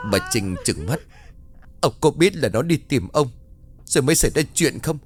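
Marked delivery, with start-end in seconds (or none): 1.8-5.0 bitter accusation. A narrator's reading. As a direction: hurl the blame at him. Wife accuses husband.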